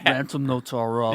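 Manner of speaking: mock phone operator. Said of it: "and they're like".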